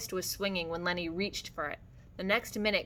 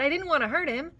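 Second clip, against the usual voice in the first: high, loud, plaintive wail; distressed cry; 9 semitones higher, 5 dB louder than usual. A narrator's reading. Lenny after crushing Curly's hand, high and distressed. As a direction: wail it in remorse. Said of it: "Lenny cried".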